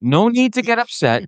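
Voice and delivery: calm him down like the driver; cabbie soothing. Retold or imitated. Retold driver soothing an angry passenger. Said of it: "I'm not gonna do the accent".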